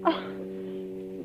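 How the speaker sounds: startled gasp. Acted. Startled by a foghorn.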